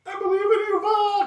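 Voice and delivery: very high, loud shout of encouragement; excited cheer. Pretend. Player cheering on Vox, excited cheer. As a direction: cheer them on at the top of your range.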